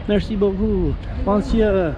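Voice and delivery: light mock French accent on Merci beaucoup. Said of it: "they have that French accent".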